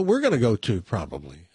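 ordinary delivery

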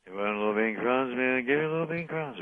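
put-on lightly slurred drunk voice; town drunk. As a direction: play it like a tipsy old drunk. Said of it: "the town drunk. You're doing Otis".

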